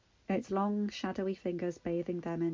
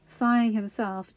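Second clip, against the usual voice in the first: usual speaking voice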